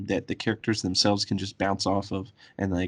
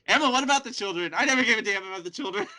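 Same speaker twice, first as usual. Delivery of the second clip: high, fast, laughing; high-pitched mock voices; 11 semitones higher, faster than usual. Acted exchange, Emma's indifferent reply.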